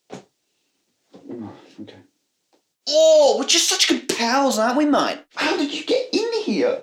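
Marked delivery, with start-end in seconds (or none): 2.9-5.2 high, hammed-up Aussie pal; chummy cartoon Australian; bad Australian accent. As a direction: put on an over-the-top Australian twang. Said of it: "is that how Australian people speak".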